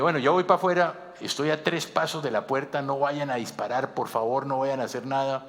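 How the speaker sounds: loud and high, pleading not to shoot; calling out. Retold or imitated. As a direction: shout to the police through the door. Calling out to armed police.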